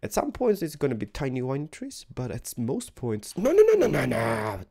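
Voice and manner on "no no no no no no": loud, high panicked cry; real frustrated yelling. Real, not acted. Sapling placed in wrong spot.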